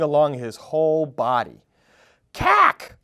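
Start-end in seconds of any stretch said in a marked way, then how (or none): 2.3-3.0 high, loud shocked cry of cack; jolted by seeing green skin; startled gasp. Acted. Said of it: "he gasped".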